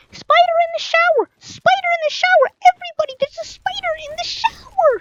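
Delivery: high yelling shout. Retold or imitated. Boy in the shower shrieking to everyone about the spider, high panicked yell.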